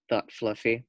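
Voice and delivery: ordinary delivery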